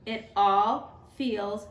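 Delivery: gentle storybook read-aloud; teacher reading a picture book to students; reading to children. Acted.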